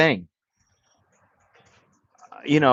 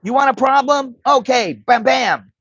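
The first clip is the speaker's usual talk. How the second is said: higher and louder, squaring up for a fight; aggressive yell; 9 semitones higher, 3 dB louder than usual. Retold or imitated. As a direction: bark it like he's about to swing. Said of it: "he just goes".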